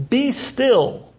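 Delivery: mildly acted rebuke; commanding. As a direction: issue a weighty two-word order. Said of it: "commanded the sea".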